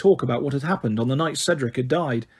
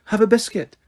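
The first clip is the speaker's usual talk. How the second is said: higher, clipped repeat; impatient; 7 semitones higher than usual. A narrator's reading. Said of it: "she repeated impatiently".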